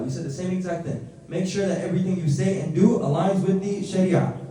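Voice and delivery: normal talking voice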